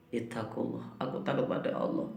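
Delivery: breathy, unvoiced whisper of fear of God; fearful whisper. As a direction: whisper it, trembling before God. Man refuses sin, fearful. (bisikan penuh rasa takut kepada Allah)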